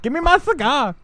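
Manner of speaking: high, flamboyant action-star voice; Arnold Schwarzenegger. Acted. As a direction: flamboyant mock Austrian accent. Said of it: "Arnold turned flamboyant".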